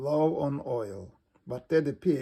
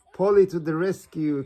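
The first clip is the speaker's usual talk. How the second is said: loud, higher cheer; cheering cry; 4 semitones higher, 7 dB louder than usual. A narrator's reading. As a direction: hail the parrot's rescue. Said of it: "cries Grandpa Pig".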